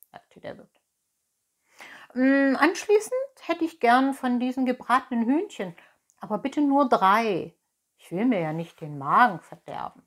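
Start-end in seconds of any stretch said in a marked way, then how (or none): 2.1-10.0 louder, lightly voiced fox piling up his order; greedy fox. A narrator's reading. Fox orders from the innkeeper, loud, lightly voiced. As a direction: order with mock modesty as a fox.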